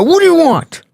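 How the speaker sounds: high-pitched, defiant retold demand; confrontational challenge. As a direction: challenge the intruders, pitch jumping way up. Jumps up confronting cell intruders.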